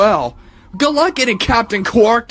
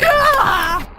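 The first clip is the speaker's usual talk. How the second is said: startled gasp; 3 dB louder than usual. Acted. Robot startles at Ratchet.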